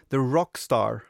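usual speaking voice

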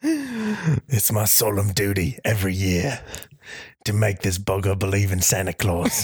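mock trailer narration by a tough guy; Jason Statham. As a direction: vow it gravely in a put-on British voice. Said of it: "My name is Jason Statham".

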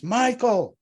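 high, loud cry of his name; acted scream, pitched well up. A narrator's reading. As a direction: cry out to him down the phone. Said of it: "She screams".

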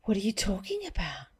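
shocked whisper. Real, not acted.

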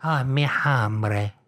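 slow, drawn-out mock Spanish; Spanish accent. Spanish turn in the language joke, slow Spanish accent.

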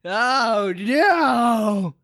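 hammed-up high-pitched outrage; slow, mock-offended old man; gasp, then demand it high and drawn out; Master Roshi.

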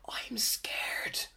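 tiny unvoiced whisper; mock scared whisper. Friend speaking to the girls, in a mock scared whisper. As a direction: whisper it like a frightened friend, imitated.

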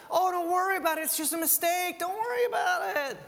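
high breezy don't-worry voice; mock reassurance. Preacher mocking a comforting take on Jesus, high and breezy. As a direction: wave it all away lightly.

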